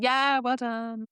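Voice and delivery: dry grudging praise. Retold or imitated. Apprentice's dry reply.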